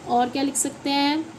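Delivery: ordinary talk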